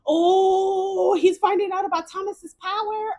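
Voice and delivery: loud, high, thrilled outburst; excited realisation. Real, not acted. Streamer realising Thomas is found out, loud excited outburst. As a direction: burst out as the twist clicks.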